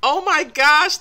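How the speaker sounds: ordinary delivery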